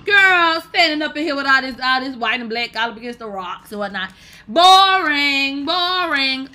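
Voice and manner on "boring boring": loud, higher drawn boring; loud mock boredom. Real, not acted. Calling the video boring out loud. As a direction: groan it out louder, bored.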